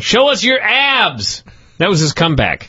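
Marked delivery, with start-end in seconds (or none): 0.0-1.4 high, drawn-out imitation of a wrestler screaming; mock scream. Co-host retelling Steiner's comeback to Bubba, mock screaming. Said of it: "Steiner started screaming".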